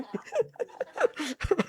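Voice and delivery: a high, soft laugh; quick amused laugh. Real, not acted. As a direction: laugh at your own joke. Laugh after joke.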